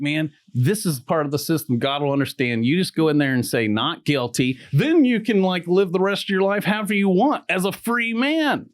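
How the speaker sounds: a lawyer annoyed at his client's plan; pissed-off attorney. Retold or imitated. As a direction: push back hard, warning him off. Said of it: "he got pissed".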